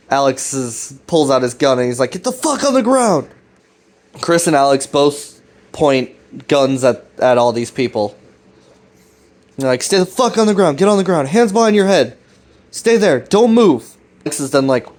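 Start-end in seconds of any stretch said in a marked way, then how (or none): 2.1-3.3 higher mock yell of a gun-drawn order; retold shout at cultists, pitched high; yelled command. Alex pulls gun, orders cultists down. 9.8-14.0 higher, rising yelled commands, loudest at the end; mock police shouting at gunpoint; shouted orders. Holding cultists at gunpoint.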